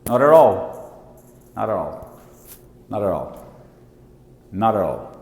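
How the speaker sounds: American "Not at all" as nada-rall; American linking, "Not at all" as one word; American accent. Shown as a demonstration. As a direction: flap both t's of "Not at all", American style. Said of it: "nos Estados Unidos, gente, esse T nunca vai ser T".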